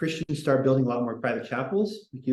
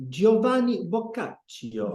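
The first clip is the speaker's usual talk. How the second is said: Giovanni Boccaccio in a hammed-up Italian accent, higher and slow; mock Italian accent; 8 semitones higher, much slower than usual. Shown as a demonstration. Teacher mocking fancy pronunciation to the class, exaggerated Italian accent. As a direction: roll out Giovanni Boccaccio like a pompous Italian, slowly. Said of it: "let me read it more potentially".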